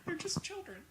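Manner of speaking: high sitcom dad delivery; fifties sitcom dad voice. Pretend. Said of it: "Is this your fifties sitcom dad voice?".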